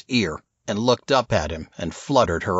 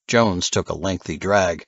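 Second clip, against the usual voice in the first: plain reading voice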